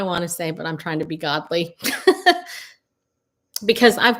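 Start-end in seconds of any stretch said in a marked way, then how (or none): 1.8-2.6 high bright burst of laughter; quick amused laugh; short laugh. Real, not acted. Laughing at own remark.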